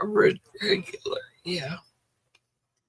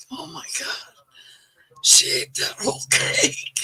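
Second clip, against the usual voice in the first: hushed mock gossip, half laughing; imitating the kids' awed whisper; 5 semitones higher than usual. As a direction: whisper it like giggling kids. Kids mocking her eating cake.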